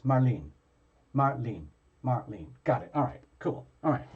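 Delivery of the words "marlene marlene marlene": Marlene as mar-LEEN, falling each time; two syllables. Shown as a demonstration. Host rehearsing a client's name, Marlene as two-syllable mar-LEEN. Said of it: "it's two syllables".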